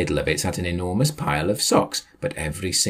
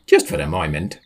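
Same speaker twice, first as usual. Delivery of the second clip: plain reading voice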